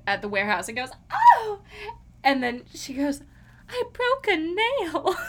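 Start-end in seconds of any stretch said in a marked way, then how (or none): 1.1-1.6 high, loud mock shriek; imitated diva scream, pitched way up; mimicked scream. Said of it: "when she screams at the". 3.7-5.2 high, slow wail of mock despair; drawn-out, overdramatic imitation of heartbreak; hammed-up devastation. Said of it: "her devastation is just so".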